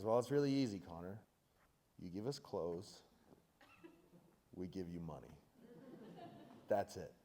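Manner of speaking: a lightly voiced know-it-all buyer; imitating the buyer. Buyer explains to young Connor.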